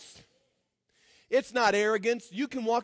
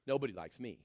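much quieter and lower, a mock mope; mock self-pity; 10 semitones lower, 9 dB quieter, faster than usual. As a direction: sulk it out in parody. Mock self-pity after a laugh.

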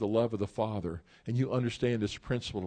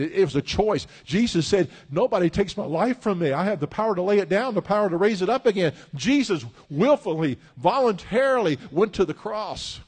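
loud, higher, driving sermon voice; full-force preaching; 7 semitones higher, 6 dB louder than usual. Acted. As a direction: preach it with rising fire.

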